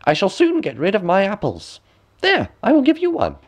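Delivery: high, quick coaxing crone; high wheedling crone; old woman. A narrator's reading. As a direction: tempt her like a sly hag. Peddler woman speaks.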